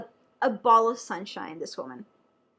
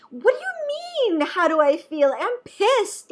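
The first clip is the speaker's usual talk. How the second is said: much higher and louder, an irritated retort; exasperated outburst; 9 semitones higher, 6 dB louder than usual. Retold or imitated. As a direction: snap it back, fed up with the question. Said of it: "that would just piss me off so much more".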